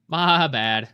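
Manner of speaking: emphatic repeat of "My bad.". Shown as a demonstration. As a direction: belt out "My bad." as a bigger admission.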